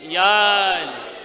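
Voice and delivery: drawn-out, low call; slow call of devotion. Acted. Preacher calling out the name with the congregation.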